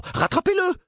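very high, sudden exclamation; alarmed cry. A narrator's reading. Nurse sees the boy falling off the table, crying out. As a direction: cry out to stop a fall. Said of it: "s'écria l'infirmière".

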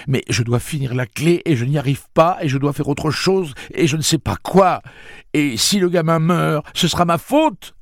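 voix très haute et forte, affolée; supplie, au bord de la crise; panique. Acted. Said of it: "La panique eut finalement raison de lui". (Eddie agrippe Roland, paniqué)

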